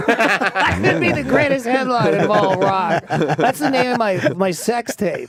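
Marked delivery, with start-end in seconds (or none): none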